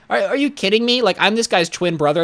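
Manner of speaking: high-pitched indignant disbelief; mock incredulity. Bagger as fake twin challenging the customer, high incredulous protest.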